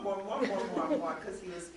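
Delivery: ordinary talk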